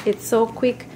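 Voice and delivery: ordinary talk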